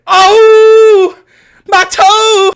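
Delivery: high, loud wail of agony; hammed-up stubbed-toe scream; mock pain howl. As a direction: howl like you just stubbed it.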